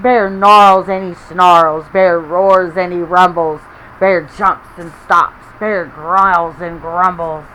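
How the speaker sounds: loud, slow, snarling storytelling; bear-angry read-aloud voice; growly loud reading. A narrator's reading. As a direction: roar it out for the kids. Dramatic angry bear lines.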